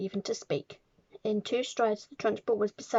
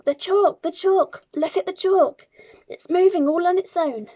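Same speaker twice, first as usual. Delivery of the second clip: high, loud, shrill schoolboy alarm; hysterical scream; 8 semitones higher, 9 dB louder than usual. A narrator's reading. Nigel alerts class to moving chalk, hysterical scream. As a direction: shriek for everyone to look. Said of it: "So hysterical and shrill was Nigel's scream".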